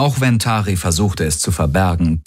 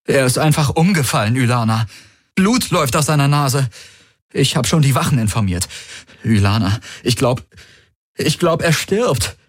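higher, rushed then slowing on the end; faked panic; 6 semitones higher than usual. Valur lying to Ylana, rushed fake panic. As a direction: sell the fear.

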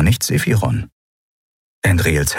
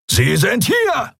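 bellowing; slower than usual. A narrator's reading. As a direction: bellow it to the others. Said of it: "brüllte einer von ihnen".

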